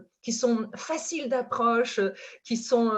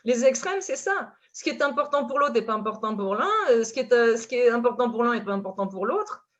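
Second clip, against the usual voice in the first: ordinary talk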